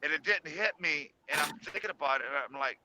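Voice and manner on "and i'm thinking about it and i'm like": normal talking voice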